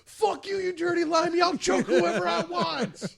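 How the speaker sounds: high yelling, half laughing. Pretend. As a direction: shout it like a hotheaded cop. Playing the angry cop.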